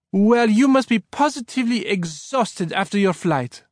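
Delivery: put-on high, lively French-accented woman; bright. A narrator's reading. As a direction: fuss over a guest brightly, French accent. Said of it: "said Elisa brightly".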